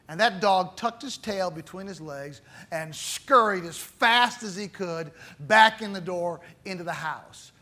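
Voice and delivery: ordinary delivery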